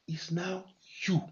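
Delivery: whispered, soft and intimate; sudden whisper. Acted. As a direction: drop to a whisper to draw them in. Sudden hush after loud preaching.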